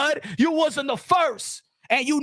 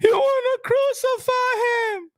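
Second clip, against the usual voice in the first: higher, slower, whining mock outrage; 10 semitones higher, slower than usual. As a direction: parrot their outrage in a higher drawn-out whine. Repeat in mocking voice.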